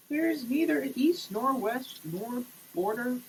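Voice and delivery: ordinary talk, nothing marked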